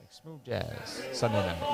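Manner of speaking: lightly voiced late night radio host; soft late night jazz DJ voice; smooth jazz DJ. Shown as a demonstration. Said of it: "Like the smooth, the smooth jazz D J".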